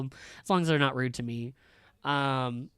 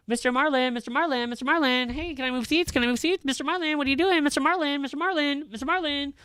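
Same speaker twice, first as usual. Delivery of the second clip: put-on high nagging kid voice, way up in pitch; mock pestering student, high and insistent; whiny student; 11 semitones higher, 4 dB louder than usual. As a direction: whine the name over and over like a pestering teenager. Teacher mocking students pestering him.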